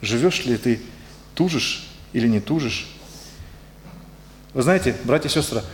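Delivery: normal talking voice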